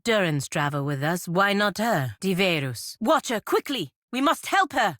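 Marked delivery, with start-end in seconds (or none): 3.0-5.0 urgent, much higher call for help. Acted. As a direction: rush the Watcher to help her.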